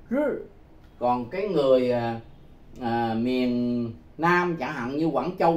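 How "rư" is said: rư said as the Mandarin reading, pitched high. Shown as a demonstration. Teacher showing students the Mandarin reading, rư pitched high. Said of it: "thì họ đọc là chữ rư".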